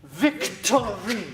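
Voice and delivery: "Victory" stretched slowly, each syllable separate. Shown as a demonstration. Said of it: "syllable by syllable, very methodically".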